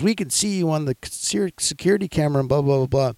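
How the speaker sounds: Rachel Hogan. Retold or imitated. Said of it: "And she goes".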